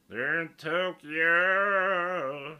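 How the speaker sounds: very loud, high country holler, Tokyo hugely drawn out; milk Tokyo in a huge country twang; exaggerated country voice. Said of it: "You guys like my country voice?".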